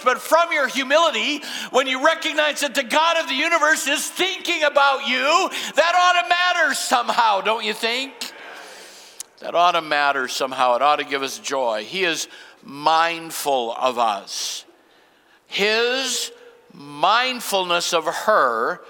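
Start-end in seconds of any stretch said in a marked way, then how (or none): 0.0-7.4 climbing higher and higher in pitch; preaching climb; rising preaching fervor. Real, not acted. Preacher building to a peak. 9.4-14.6 calm restatement. Real, not acted. Preacher drops after climax.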